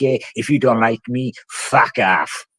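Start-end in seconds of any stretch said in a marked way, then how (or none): none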